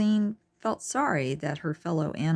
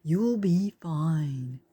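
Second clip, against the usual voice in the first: slow, gentle reassurance; calm her down slowly; reassuring; much slower than usual. A narrator's reading. Tor holding Charlene's hand, slow gentle reassurance. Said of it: "Tor reassured".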